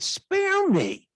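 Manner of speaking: high mock-scandalised exclamation; mock shock. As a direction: pretend to be scandalised. Doctor retells his mock shock.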